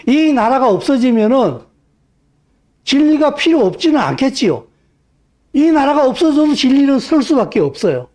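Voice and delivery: high, loud sermon in full cry; fiery preaching. Acted. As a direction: preach it hard at the congregation.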